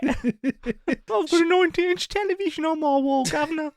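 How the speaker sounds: hammed-up, very high squawky impression; mock celebrity bragging in a squeaky put-on voice. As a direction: pitch it way up, joking through laughter. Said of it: "Was that your [name] impression?".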